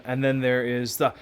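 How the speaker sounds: ordinary talk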